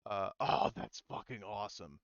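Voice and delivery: ordinary talk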